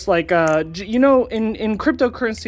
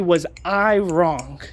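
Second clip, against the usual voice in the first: ordinary talk, nothing marked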